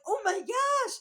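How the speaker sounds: hammed-up scandalised squeal; playing Adam and Eve caught naked; mock shock. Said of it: "they're like".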